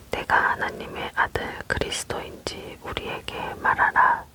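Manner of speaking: usual speaking voice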